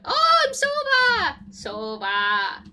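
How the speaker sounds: a high, loud burst of delight on "Sova"; excited cheer. Real, not acted. Player thrilled to be playing Sova, excited shout. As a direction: shout the name with real glee. Thrilled to get his agent.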